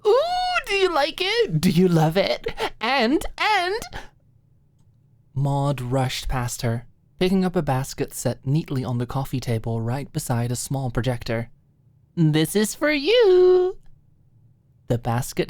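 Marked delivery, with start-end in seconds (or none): 0.1-3.8 very high, gushing squeal; squealing excitement. Acted. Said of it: "with a squeal". 12.2-13.8 put-on high, slow, louder girlish presentation; proud offering. Maud presents the gift basket.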